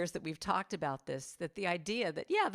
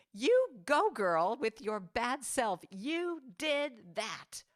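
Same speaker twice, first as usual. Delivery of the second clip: much higher, louder, slow cheer; triumphant cheer; 4 semitones higher, 4 dB louder, much slower than usual. Retold or imitated. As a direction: cheer for herself on stage. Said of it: "I want to say to myself".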